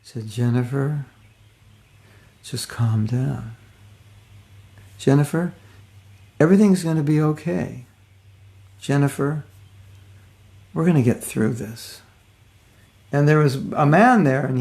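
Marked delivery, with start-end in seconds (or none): 0.3-12.0 acted calm reassurance; really calm. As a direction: soothe gently, with long pauses between phrases. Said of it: "really calm".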